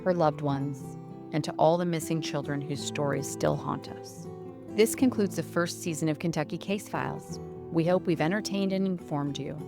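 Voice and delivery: ordinary delivery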